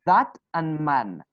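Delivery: "that" and "man" slow and apart, t unchanged; separate words. Shown as a demonstration. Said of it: "are we going to change it".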